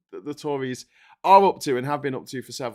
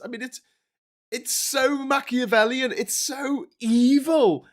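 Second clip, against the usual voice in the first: normal talking voice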